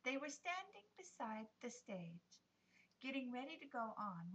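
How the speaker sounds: ordinary delivery, nothing marked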